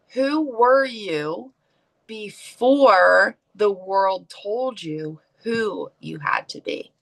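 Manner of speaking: very slow, each word weighed, ending in a whisper; slow, deliberate repeat. Shown as a demonstration. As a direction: let the question sink in, slowly. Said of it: "repeated slowly for emphasis".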